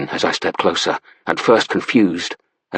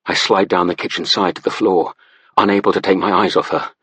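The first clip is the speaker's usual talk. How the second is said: usual speaking voice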